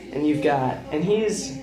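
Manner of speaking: ordinary talk, nothing marked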